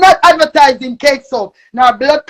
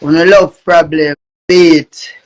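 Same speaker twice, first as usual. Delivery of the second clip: deep voice; 10 semitones lower, slower than usual. Acted. Heavy deep intro catchphrase.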